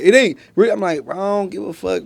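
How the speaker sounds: usual speaking voice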